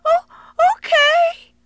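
put-on high little boy calling up; child voice. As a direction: answer from down the well. Boy answers from the well.

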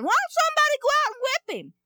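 put-on high, fast boyish voice; eager young boy urging someone on; piping boy's indignant question; young David. Boy David asks the soldiers.

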